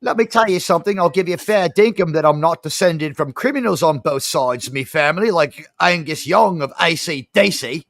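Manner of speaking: hammed-up Aussie fair-dinkum accent; Australian accent. Answering Carla's teasing in a hammed-up Australian accent. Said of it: "refrain from doing that accent ever again".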